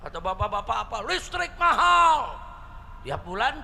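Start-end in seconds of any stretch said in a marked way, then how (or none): 1.1-2.3 imitating a high, drawn-out yell; protesting shout. Fathers complaining about electricity prices, shouting. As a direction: call it out, stretching the words. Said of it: "Ada lagi nih bapak-bapak teriak".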